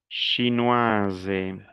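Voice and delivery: "chinoise" drawn out, ending in a voiced z; sounded feminine ending. Shown as a demonstration. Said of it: "el femenino es". ("chinoise" pronunciando la s como z)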